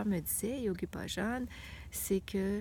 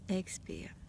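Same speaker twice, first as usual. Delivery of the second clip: whispered breath cue; 5 dB quieter than usual. Shown as a demonstration.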